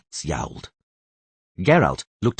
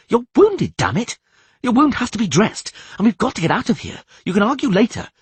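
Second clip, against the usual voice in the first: high, loud and pressing; urgent shout; 12 semitones higher, 3 dB louder than usual. Acted. Dandelion urges the bleeding Geralt, high loud shouts. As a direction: hurry him along, shouting. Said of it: "shouted Dandelion".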